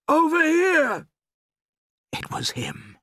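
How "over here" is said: very high, loud holler; calling out. A narrator's reading. Father guiding his son through the wood, calling out. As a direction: shout to guide someone to you. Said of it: "the voice called out".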